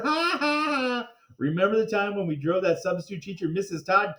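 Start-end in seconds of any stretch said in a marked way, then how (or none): 0.0-1.1 high, slow hammed-up ha ha ha; kids' high gleeful laugh; high mock laugh. Kids laughing at joke.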